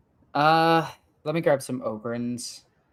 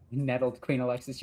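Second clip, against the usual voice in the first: ordinary talk, nothing marked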